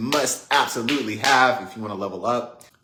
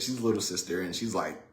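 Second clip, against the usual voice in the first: ordinary delivery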